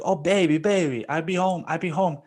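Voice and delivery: high, fast pleading on the phone; hammed-up placating husband; mock pleading boss.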